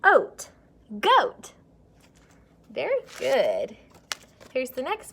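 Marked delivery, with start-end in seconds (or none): 0.0-1.5 "goat" lifted high and loud; excited blend. Shown as a demonstration. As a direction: sing "oat" and "goat" up high.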